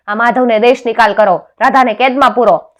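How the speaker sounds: loud, high-pitched shouted order; royal command. A narrator's reading. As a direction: hand down the sentence with full force. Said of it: "રાજાએ હુકમ કર્યો". (રાજાનો બુલંદ હુકમ)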